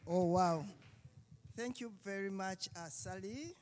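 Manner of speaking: ordinary delivery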